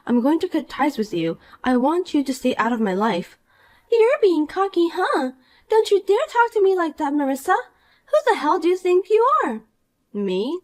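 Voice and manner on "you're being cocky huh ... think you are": put-on bright, higher voice of a smug mean girl; snide rich girl. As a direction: talk down to her with sweet contempt. Poppy snaps back.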